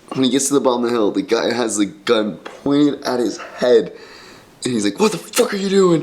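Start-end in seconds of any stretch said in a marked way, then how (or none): 5.0-6.0 mock angry shout at the boy; angry challenge. Gunman at the hill bottom confronting Dave, mock angry. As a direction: imitate the gunman barking a challenge.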